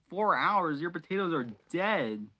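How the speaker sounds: loud, high exclamation; shocked outburst. Real, not acted. Reacting to forgotten potatoes, shocked and loud. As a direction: blurt it out in disbelief.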